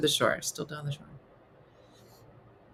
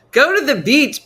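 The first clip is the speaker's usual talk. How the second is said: louder and higher, mocking the phrase; loud mocking repeat; 8 semitones higher, 9 dB louder than usual. Shown as a demonstration. Mocking listeners who say beach, loud. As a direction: belt out go to the beach to mock it.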